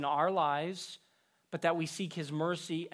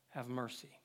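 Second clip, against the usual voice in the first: "have mercy" dropped much lower and softer, almost breathed; fading plea; 6 semitones lower, 12 dB quieter than usual. Acted. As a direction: let the last plea die away to a hush. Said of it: "Look at the repetitiveness with the emphasis".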